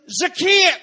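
high, loud call up into the tree. A narrator's reading. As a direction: call his name up to the tree. Jesus calls up into tree.

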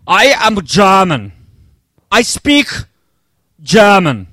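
light mock German accent, slow and deliberate; a preacher's hint of a German accent. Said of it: "I'm just doing an accent thing".

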